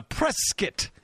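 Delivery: Prescott said "PRESS-kit"; Prescott, second syllable reduced to "kit"; local pronunciation. Shown as a demonstration. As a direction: say Prescott with a short "kit" at the end. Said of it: "now know how to pronounce".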